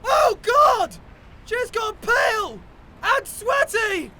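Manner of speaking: very high-pitched cries of horror; hysterical, drawn-out acted alarm; panicked shouting. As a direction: shout each short phrase in panic. Panicking over poisoned friend.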